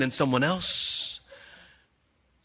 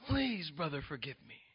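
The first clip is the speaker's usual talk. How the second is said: soft pleading; 12 dB quieter than usual. Retold or imitated. Jacob's silent plea to Esau.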